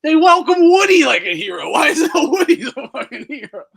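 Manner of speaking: loud, disbelieving outburst; real exasperated shouting; outraged shout. Real, not acted. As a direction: yell it in outrage. Outraged rant about Woody.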